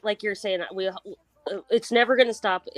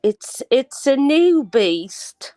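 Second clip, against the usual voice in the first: attempted British accent, very slow and high; 5 semitones higher, 4 dB louder, much slower than usual. Shown as a demonstration. Co-host having a go at the requested line, drawn-out British accent. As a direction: stretch it out in a put-on English accent. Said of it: "or British accent and say".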